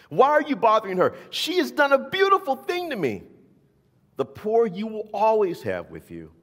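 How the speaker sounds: high, loud fervent defense; impassioned rebuke. A narrator's reading. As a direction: defend her against them. Jesus rebukes the disciples.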